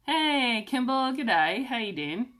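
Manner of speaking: a cheerful mock Australian g'day; bad Australian accent. Greeting viewer Kimball in a jokey, bad Australian accent. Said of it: "my best, worst Australian accent".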